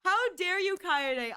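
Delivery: teasing how-dare-you; mock outrage. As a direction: play offended for laughs.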